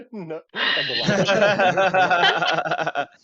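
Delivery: laughing hard through the words; breaking up with laughter. Real, not acted. Player cracks up laughing.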